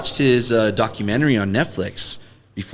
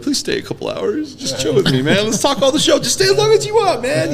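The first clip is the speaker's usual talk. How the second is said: much higher begging whine; mock pleading. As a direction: plead with guests to stay. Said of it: "I would be like".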